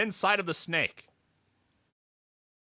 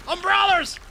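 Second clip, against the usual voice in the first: alarmed cry; 13 semitones higher, 6 dB louder than usual. Acted. Umbrellas attack again.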